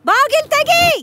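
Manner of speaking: bang out the order to open up; shouted demand. Acted. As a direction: sister shouting at the door to open.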